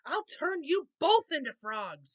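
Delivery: loud, high furious shout; furious cry. A narrator's reading. Wicked Elf raging at Star and Moon, loud furious threat. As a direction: threaten them in a rage. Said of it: "he cried".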